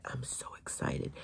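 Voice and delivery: whispered, hushed excitement; whispering excitement about the new set; excited whisper. Real, not acted. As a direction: whisper it like a thrilling secret.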